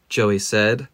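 ordinary delivery, nothing marked

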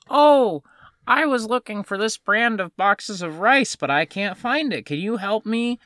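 put-on high-pitched little old lady voice. Little old lady asking store worker for help finding rice, high-pitched. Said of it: "this little old lady is like".

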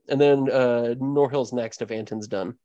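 usual speaking voice

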